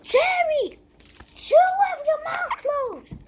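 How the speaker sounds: loud high scolding. Real, not acted. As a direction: bark at someone to stop.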